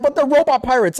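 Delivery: high mock-whiny disbelief; incredulous protest. Repeating the same retort.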